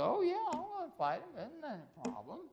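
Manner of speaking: put-on high, quick boyish voice; eager shepherd boy volunteering, pitched high and fast; young David. David telling King Saul he'll fight, high boyish voice.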